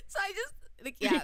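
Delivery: laughing. Real, not acted. Laughing at own story.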